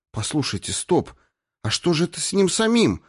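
sudden alarmed cry. A narrator's reading. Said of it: "внезапно вскричала Ирина". (внезапный встревоженный вскрик)

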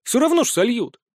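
dry sarcastic jab. Acted. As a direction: say it with full sarcasm. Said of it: "вложить в свой тон весь сарказм". (саркастично)

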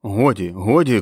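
loud, alarmed order to stop; shout. A narrator's reading. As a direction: raise the voice urgently in protest. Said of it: "крикнув Стю". (стривожений окрик)

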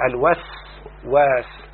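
الوسواس said slowly as "al-was... waas"; split syllables. Shown as a demonstration. Teacher showing the student the doubled letters, الوسواس slowly split. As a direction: break الوسواس in two, drawing out each half.

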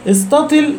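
استطل said with the ta opened, istatal; fatha on the ta. Shown as a demonstration. Teacher showing one reading of the word, with a lifted pitch. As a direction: say استطل with a clear a after the t. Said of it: "بفتح التاء".

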